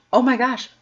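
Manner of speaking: a burst of real amazement; stunned delight; surprised. Real, not acted. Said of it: "repeated exclamation".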